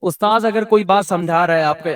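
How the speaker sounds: normal talking voice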